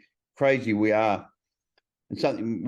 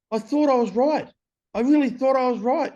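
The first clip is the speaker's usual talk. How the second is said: insistent, much higher self-defence; high-pitched protest; 12 semitones higher, 3 dB louder than usual. Real, not acted. Explaining himself after apologising to his wife, high protest. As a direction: protest it way up in pitch, half comic.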